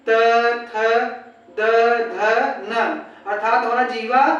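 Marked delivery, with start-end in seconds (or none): 0.1-3.0 त, थ, द, ध, न separately, tongue touching the teeth; isolated dentals त, थ, द, ध, न for pupils to try; dental consonant demo. Shown as a demonstration. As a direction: sound out त, थ, द, ध, न with gaps. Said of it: "बोल के देखिए".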